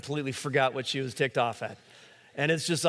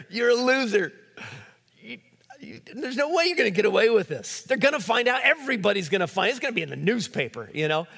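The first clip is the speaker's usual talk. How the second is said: higher taunting voice of the devil, mostly lightly put on; mocking devil voice; 7 semitones higher than usual. Preacher voicing Satan taunting the sinner: higher mock sneer. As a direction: taunt the sinner, then rush the end. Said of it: "then he whispers in your ear".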